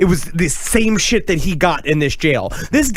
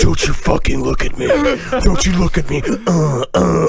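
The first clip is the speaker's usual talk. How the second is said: hammed-up prisoner in a negligee; upset prisoner. Comic riff on a prisoner modelling lingerie, mock upset. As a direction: play the upset prisoner for laughs, groaning at the end.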